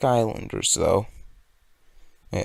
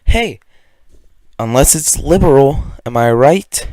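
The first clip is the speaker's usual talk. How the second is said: loud, jeering punchline; loud mock aside; 4 semitones higher, 13 dB louder than usual. Vlogger mocking the law just read, loud sarcastic jab. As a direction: call it out like a heckler's joke.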